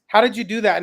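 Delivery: ordinary talk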